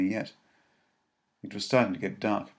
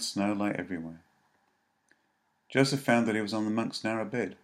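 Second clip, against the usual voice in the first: usual speaking voice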